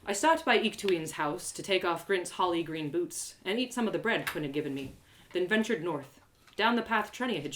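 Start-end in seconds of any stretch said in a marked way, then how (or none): none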